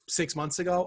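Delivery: ordinary talk, nothing marked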